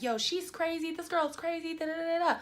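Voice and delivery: high, dismissive mimicry of a ranting friend; mocking imitation. Imitating passenger complaining to others, mocking high rant. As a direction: parrot her rant up high, into babble.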